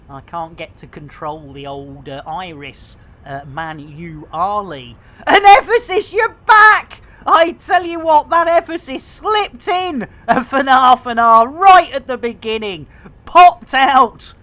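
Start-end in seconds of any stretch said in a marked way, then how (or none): 5.2-14.3 loud, high, bad Glaswegian patter; terrible Glaswegian accent. Streamer greeting a returning viewer, terrible Glaswegian accent. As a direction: put on a hammy Glaswegian accent. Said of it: "after that terrible Glaswegian accent".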